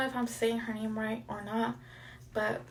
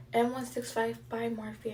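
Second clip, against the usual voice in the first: ordinary talk, nothing marked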